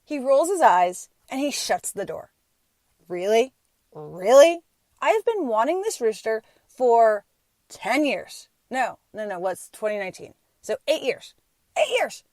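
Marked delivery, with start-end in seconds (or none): 3.1-12.2 wife protests to her husband about the rooster, indignant; indignant outburst. Retold or imitated. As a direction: rail at him in disbelief.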